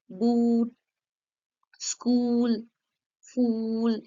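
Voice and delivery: बूट, स्कूल, फूल with a long drawn ऊ; teacher modelling the long ऊ vowel; long oo demo. Shown as a demonstration. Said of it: "ऊ, बड़ा".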